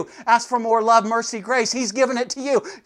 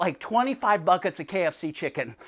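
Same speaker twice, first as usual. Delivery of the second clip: ordinary delivery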